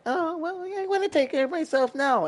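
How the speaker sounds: high, whiny mock voice; mocking other people. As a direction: parody a naive, self-satisfied person. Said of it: "You know, they think".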